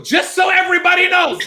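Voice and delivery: normal talking voice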